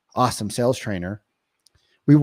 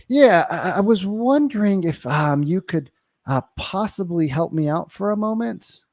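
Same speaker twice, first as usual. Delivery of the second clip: higher, hesitant request for help; curious transition; 6 semitones higher than usual. Shown as a demonstration. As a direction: wonder aloud with filler words, tentatively. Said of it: "learn to use transitioning statements".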